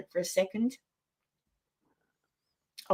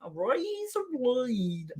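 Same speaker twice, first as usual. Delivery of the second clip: slow, stretched American razor blade attempt; failed American accent; 4 semitones higher, 3 dB quieter, much slower than usual. Shown as a demonstration. Australian fails to copy the host's American razor blade. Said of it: "I can't do it".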